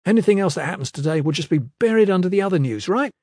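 high-pitched, agitated rant; furious. A narrator's reading. Kip rails to Iron Fist about Andros's plan, furious. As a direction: rail against the scheme, pitch held high. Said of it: "Kip was furious".

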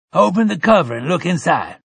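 high, loud elder's urging; an old man, moved, urging gently. A narrator's reading.

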